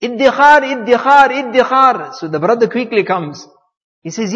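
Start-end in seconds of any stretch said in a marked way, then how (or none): 0.0-2.2 loud, high repeated name-call; retold screaming for someone. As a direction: imitate a desperate shout for a brother. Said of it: "He's screaming for his brother".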